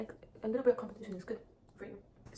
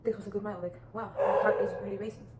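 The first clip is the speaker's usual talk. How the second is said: louder, thrilled cheer about a good run; upbeat inner voice; 8 dB louder than usual. Pretend. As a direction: sound pumped after a good mile, heart racing. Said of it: "Just be like".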